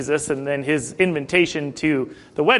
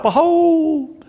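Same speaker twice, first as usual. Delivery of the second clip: high, drawn-out call; herald's cry, sung out long and high; acted shout; 12 semitones higher, 4 dB louder than usual. A narrator's reading. Midnight cry to the sleeping virgins, high drawn-out shout. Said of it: "there was a shout".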